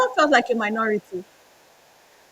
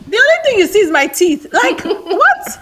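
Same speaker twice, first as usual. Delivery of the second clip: laughing; 8 semitones higher, 4 dB louder than usual. Real, not acted. Laughing through the photo story.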